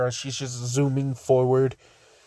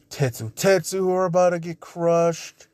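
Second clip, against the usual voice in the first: normal talking voice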